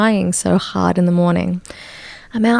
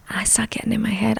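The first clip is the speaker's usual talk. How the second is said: whispered aside; 3 semitones higher, 7 dB quieter, faster than usual. Real, not acted. Sudden unvoiced aside.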